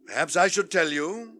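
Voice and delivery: normal talking voice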